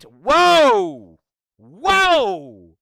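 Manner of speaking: high, loud exaggerated whoa; mock shocked whoa. Mocking the Nazis, a big loud whoa. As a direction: gasp out a hammed-up whoa at the joke.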